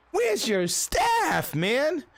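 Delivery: high, stretched-out squeal of disbelief; incredulous customer. Retold or imitated. Customer confronting a nearly empty slammed restaurant, incredulous. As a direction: draw out "staff" in high-pitched outrage.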